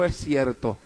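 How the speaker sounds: low, soft confession; subdued, dropped-voice admission; retold tearful admission. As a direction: admit it quietly, imitating someone in tears. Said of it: "ella lloró y me dijo".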